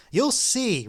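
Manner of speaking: mild mock roar; roaring threat. A narrator's reading. Enthusiast threatening as he leaps, roaring. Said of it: "roared the enthusiast".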